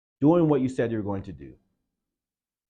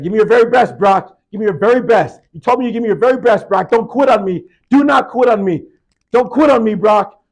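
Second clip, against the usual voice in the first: very loud, high screaming coach; 10 semitones higher, 14 dB louder than usual. Retold or imitated. Coach screaming at his player during the death crawl. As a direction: scream him forward with everything.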